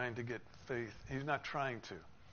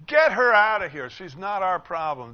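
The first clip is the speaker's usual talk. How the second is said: much louder, higher angry shout; shouted dismissal; 6 semitones higher, 16 dB louder than usual. Acted. Disciples shouting at Jesus to send her away, angry yell. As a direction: shout to drive the woman away. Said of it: "So the disciples are saying".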